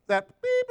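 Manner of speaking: mock censor bleep. Shown as a demonstration. Preacher censoring client's curse for church, shrill bleep. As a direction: cover the swear word with a shrill beep. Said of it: "I'm gonna bleep".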